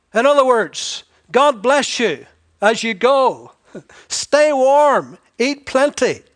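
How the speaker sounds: high, loud, breezy brush-off; hammed-up fake kindness to a hungry man; mock cheery blessing. Preacher mimicking a hypocrite sending off the poor, mock cheery.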